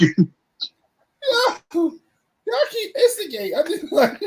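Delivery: high, cracking with laughter; stifled giggles. Real, not acted. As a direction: fight the giggles and lose. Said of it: "I'm trying not to laugh".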